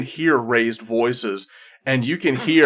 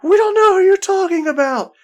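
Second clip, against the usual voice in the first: squeaky, much higher and louder protest; frightened dwarves; 6 dB louder than usual. Acted. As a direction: plead it in a shrill panic. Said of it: "all the dwarves are saying".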